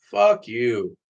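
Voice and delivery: flat, offhand curse with no emotion; a casual, uncaring fuck you; nonchalant. Shown as a demonstration. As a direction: toss it off like it means nothing. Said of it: "Notice how I say it so nonchalantly".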